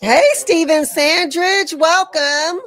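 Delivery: loud, high, drawn-out greeting; big welcome. Real, not acted. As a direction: call out a hearty hello to a newcomer. Calling out welcome to an arriving viewer.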